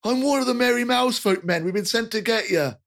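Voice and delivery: high, apologetic chubby fellow; jolly rotund man. Acted. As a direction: soothe the startled kid cheerfully. Same NPC introduces himself.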